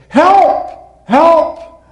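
high, loud cries for help; yelling for help. Acted. Jack hanging from a cliff, yelling for help. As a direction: shout it up the cliff. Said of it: "So Jack began yelling for help".

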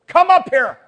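high, booming call from above; loud summons. Acted. Voice calls the people up, loud shout. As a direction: shout the command out to everyone. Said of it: "there's a voice saying to his, to the people".

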